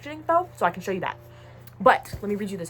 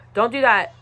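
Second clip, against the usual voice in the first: firm warning; 7 dB louder than usual. Acted. Repeats the warning.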